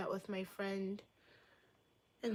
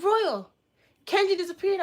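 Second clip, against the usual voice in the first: high, loud urgent report; mock alarm; 10 semitones higher, 9 dB louder than usual. Viewers telling the streamer someone vanished, in mock alarm. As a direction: cry out the news in hammed-up shock.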